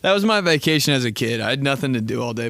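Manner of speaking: ordinary delivery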